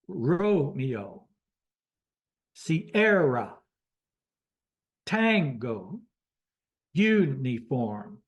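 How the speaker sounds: Romeo, Sierra, Tango, uniform one by one, stresses exaggerated; careful radio drill, Sierra leaning on AIR; phonetic alphabet demo. Shown as a demonstration. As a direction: sound out ROW-me-oh, see-AIR-ah, TANG-go, YOU-nee-form. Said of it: "shows you how you pronounce it".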